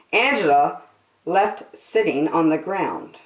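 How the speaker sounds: put-on lively read-aloud for little kids; storybook narration. A narrator's reading. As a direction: tell it like a children's storyteller. Reading picture book aloud.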